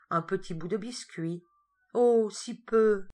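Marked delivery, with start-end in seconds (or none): 1.9-3.1 1 ironic sigh. A narrator's reading. Narrator's ironic aside on tiny gift.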